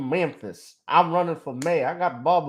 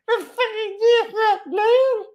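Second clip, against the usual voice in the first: much higher squeaky local guy voice, cursing; high-pitched man in a country town ranting; corner store voice. Retold or imitated. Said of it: "the corner store voice".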